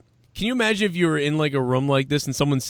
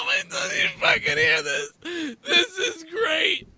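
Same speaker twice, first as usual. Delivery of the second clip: high, laughing through tears; crying with laughter; slower than usual. Real, not acted. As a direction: wheeze the words out laughing. Laughing hard at the clip.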